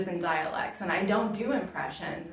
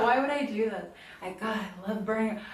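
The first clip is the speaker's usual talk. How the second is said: normal talking voice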